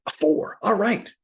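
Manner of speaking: high, loud burst of relief at the grade; excited relief. Real, not acted. Collector seeing his hoped-for grade, excited. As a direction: yelps it out, thrilled it's a four.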